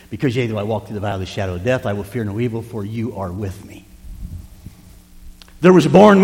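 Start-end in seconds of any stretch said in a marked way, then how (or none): 0.1-3.3 fast, soft whisper; whispered scripture. Acted. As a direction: rush the verse out in a hush.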